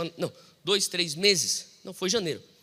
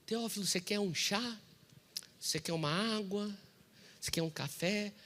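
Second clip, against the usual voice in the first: tia gentil; 11 dB quieter than usual. Retold or imitated. Tia oferece chá, gentil.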